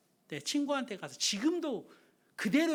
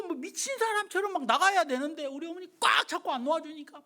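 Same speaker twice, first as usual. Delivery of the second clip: normal talking voice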